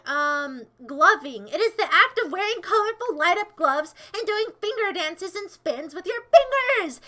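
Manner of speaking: loud, high-pitched indignant rant; exasperated explaining. Real, not acted. Streamer defends gloving to chat, exasperated.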